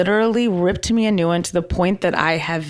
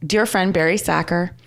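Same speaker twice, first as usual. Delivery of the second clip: ordinary talk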